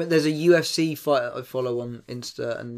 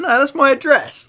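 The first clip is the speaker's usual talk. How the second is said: high, slow, louder correction; mock insistence; 11 semitones higher, 6 dB louder than usual. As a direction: act out a sketch character explaining insistently. Acting out sketch character's insistent answer.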